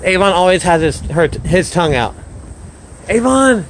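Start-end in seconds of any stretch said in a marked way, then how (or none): 3.1-3.6 high call of the dog's name. Real, not acted. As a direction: call him across the yard. Calling his dog again.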